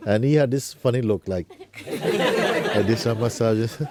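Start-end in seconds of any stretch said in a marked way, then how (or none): 1.9-3.8 words lost in laughter; half-laughed, breathless with amusement; laughing. Real, not acted. Laughing through describing his look.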